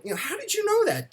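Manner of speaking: very high squeaky mock kid voice; amazed child. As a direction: imitate a little boy marvelling at his dad. Retelling child's question to dad.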